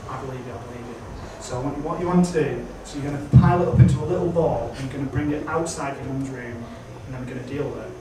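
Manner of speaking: soft, low calming voice; calm a drunk friend down quietly; soothing hush. Retold or imitated. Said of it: "And she goes".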